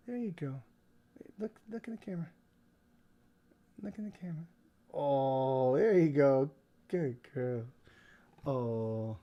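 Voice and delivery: soft, hushed coaxing; coaxing the dog. Real, not acted. Owner coaxing her puppy toward the camera, hushed and gentle. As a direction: quietly coax the puppy to look.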